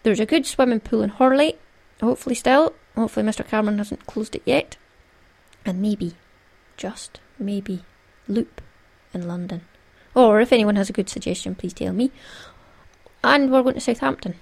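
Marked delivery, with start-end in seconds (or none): 5.6-9.6 hushed teasing whisper. Real, not acted. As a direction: whisper it like a secret. Teasing hope, unvoiced.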